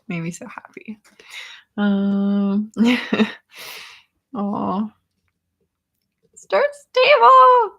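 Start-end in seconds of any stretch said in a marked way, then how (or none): 6.4-7.7 high, loud and slow, stretched out; excited drawn-out call-out. Real, not acted. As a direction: call the name out like greeting a friend across a room.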